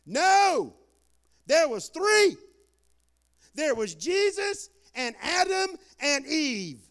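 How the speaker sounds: loud, high preacher's exclamation; emphatic correction. Real, not acted. Preacher correcting the congregation's assumption, loud and emphatic. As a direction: punch each name out with big pauses.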